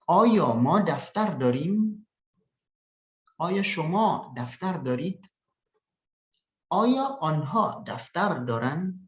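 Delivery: slow, each oyo line rising at the end; teacher drilling oyo questions with a rising tune; question intonation. Shown as a demonstration. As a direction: model the question slowly for the class. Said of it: "artinya di sini apakah?".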